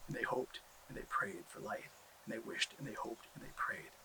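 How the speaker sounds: soft hushed storytelling. A narrator's reading. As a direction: breathe it softly, like a prayer.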